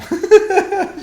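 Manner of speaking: high incredulous laugh; burst of laughter. Real, not acted. Streamer laughing at the swarm of bugs around him. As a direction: laugh it off in disbelief.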